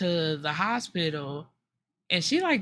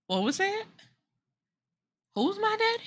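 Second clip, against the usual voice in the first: mock confrontation; 12 semitones higher than usual. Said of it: "she clears her throat like".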